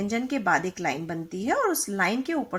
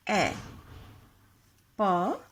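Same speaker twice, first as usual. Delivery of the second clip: normal talking voice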